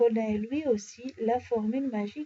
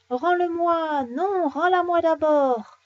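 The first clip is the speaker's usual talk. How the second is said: high, loud, drawn-out squabble; pitched-up acted demand, stretched out; quarrelling children; 6 semitones higher, 5 dB louder, slower than usual. A narrator's reading. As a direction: yell like bickering kids. Children quarrel, heard as cries.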